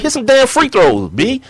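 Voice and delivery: high-pitched mock scolding; indignant, high-voiced retort; exasperated comeback. Acted scolding comeback to teammate.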